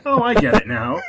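pleased mock revelation; dawning realisation. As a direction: replay suddenly getting it. Retold realisation about British accent.

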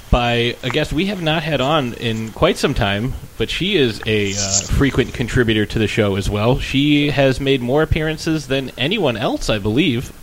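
ordinary delivery

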